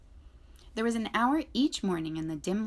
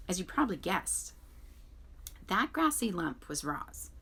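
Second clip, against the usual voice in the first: usual speaking voice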